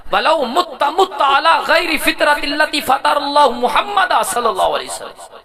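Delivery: measured recital rising to a high, ringing pitch; formal, intoned Arabic reading; Arabic hadith recitation. Real, not acted.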